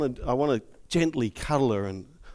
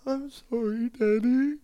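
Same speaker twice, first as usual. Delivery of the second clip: put-on small child's apology; 9 semitones higher than usual. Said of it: "she turns and says".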